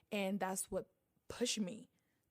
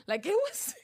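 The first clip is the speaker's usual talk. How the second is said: high, loud, cracking up mid-sentence; breaking into laughter; 7 dB louder than usual. Real, not acted. Guest recalling her clumsy first makeup videos, breaking into laughter. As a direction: dissolve into laughter as you speak.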